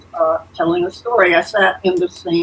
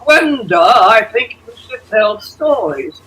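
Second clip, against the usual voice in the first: very tenor voice; 3 semitones higher, 4 dB louder than usual. Retold or imitated. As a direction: call out the name in a ringing tenor. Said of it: "he said in that very tenor voice".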